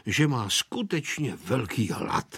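ordinary delivery

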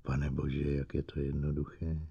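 very soft, low murmur; low, quiet dawning understanding; stunned realisation. A narrator's reading. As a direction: say it hushed, almost to yourself.

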